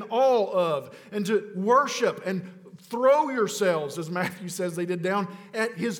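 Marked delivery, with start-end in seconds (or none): none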